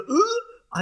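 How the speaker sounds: sudden high grunt; mock grunt. Imitating the sound he made. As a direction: grunt it out like Rocky. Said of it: "I made the sound".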